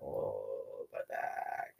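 low, hushed mock groan; retold back pain. Girl telling friend of sore back after party, low groan. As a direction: groan it softly, as if aching.

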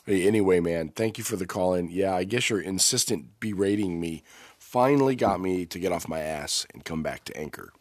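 ordinary talk

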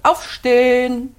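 a slow, loud drawn-out Aufstehen; mock wake-up call. Wife mimicking her husband's morning wake-up call, loud and drawn out. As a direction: call him out of bed like a drill sergeant. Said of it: "er sagt".

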